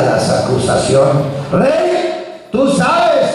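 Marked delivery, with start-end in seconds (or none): 1.5-3.3 sharply higher call of "Rey"; pushy accusers. Acted. Accusers pressing the king in a much higher insistent voice.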